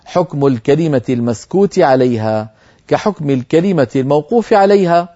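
ordinary delivery

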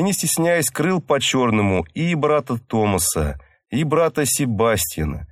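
ordinary delivery

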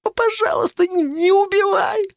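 put-on choked boy pleading not to be killed; begging. A narrator's reading. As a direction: beg desperately, stumbling on the words.

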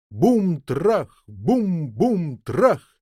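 slow, heavy imitated crashes; booming knock noises. A narrator's reading. Storyteller imitating blows that shake the house, booming. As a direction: boom out each blow separately.

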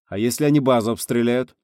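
ordinary delivery

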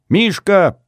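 high, loud shout of a name; yelled call. A narrator's reading. Izya yells for Mishka from out of sight, shouting. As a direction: call out sharply to a friend. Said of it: "заорал невидимый мне Изя".

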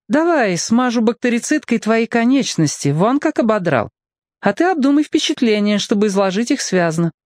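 high fussing woman's voice; fussy lady director. A narrator's reading. Voices Fräulein Dulya.

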